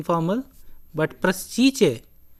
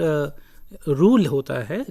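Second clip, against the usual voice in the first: ordinary talk, nothing marked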